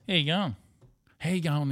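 "How you going?" squashed together, Australian style; run into one word. Shown as a demonstration. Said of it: "He makes that one word".

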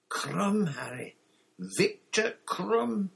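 high, drawn-out awe at a star; awestruck. A narrator's reading. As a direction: stretch the name in high amazement. Stunned Ron names the star.

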